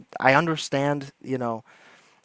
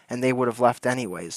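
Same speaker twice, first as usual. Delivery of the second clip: ordinary talk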